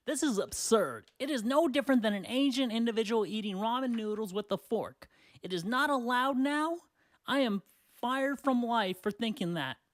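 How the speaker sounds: high, indignant read-out of a comment; mock outrage. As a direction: read it like a worked-up ranter. Reading out an outraged online comment.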